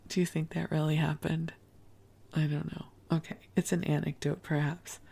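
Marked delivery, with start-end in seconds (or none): none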